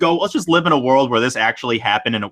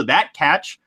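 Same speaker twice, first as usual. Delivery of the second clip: normal talking voice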